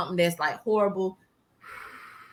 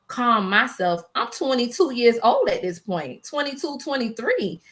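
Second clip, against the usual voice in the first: usual speaking voice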